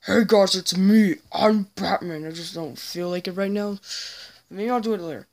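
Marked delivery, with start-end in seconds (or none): 0.0-2.3 loud, forceful superhero voice; Batman. Acted. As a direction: belt it out in the hero's voice. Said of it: "Batman voice right now, where I say".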